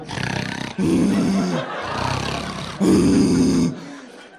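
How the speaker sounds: loud, drawn-out troll groan; night troll noise. Retold or imitated. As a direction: groan like a troll appearing from nowhere. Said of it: "you get that night troll".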